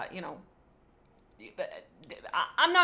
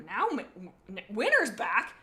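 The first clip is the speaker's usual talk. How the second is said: plain reading voice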